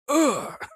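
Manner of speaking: sharp high strangled groan; mummy groan. Retold or imitated. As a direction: imitate a dying mummy's groan. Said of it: "the guy going".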